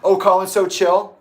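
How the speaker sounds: loud, high sarcastic question; mocking echo. Real, not acted. Answering the defender of Colin, mocking and loud. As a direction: throw the words back scornfully.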